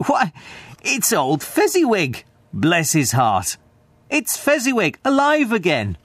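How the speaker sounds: high, thrilled outbursts of recognition; great excitement. A narrator's reading. Old Scrooge to the ghost, excited and delighted. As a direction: cry it out, overjoyed to see him. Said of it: "Scrooge cried in great excitement".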